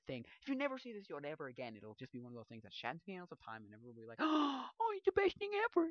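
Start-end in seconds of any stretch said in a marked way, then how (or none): 4.2-5.9 much higher and louder, gushing; imitating adoring fans; gasping mock adoration. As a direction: gasp then gush like a smitten fan.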